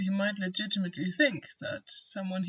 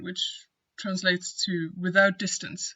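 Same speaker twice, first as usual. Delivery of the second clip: usual speaking voice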